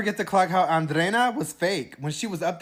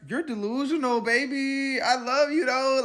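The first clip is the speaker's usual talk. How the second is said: much higher playful sing-song; teasing high voice; 9 semitones higher, slower than usual. Real, not acted. Teasing Huda about Taylor, high and playful. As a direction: tease her sweetly.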